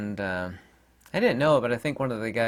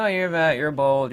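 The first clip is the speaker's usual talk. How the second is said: higher, louder mimicked taunts; mock heckling; 5 semitones higher, 5 dB louder than usual. YouTuber mimicking his critics' insults, mock whiny complaint. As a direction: rattle off the haters' jibes mockingly.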